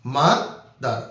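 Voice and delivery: slow mother, broken into its two syllables; slow teacher demo of mother in two parts; split into syllables. Shown as a demonstration. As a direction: stretch mother out slowly, syllable by syllable. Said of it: "যে শব্দটা ভাঙলে দুইটা অংশ পাওয়া যায়".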